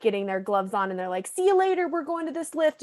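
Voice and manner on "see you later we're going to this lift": put-on high, breezy kid calling out; mock kid voice. Kids calling back to a parent, high and carefree. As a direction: chirp it like a kid heading off.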